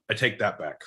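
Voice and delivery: ordinary delivery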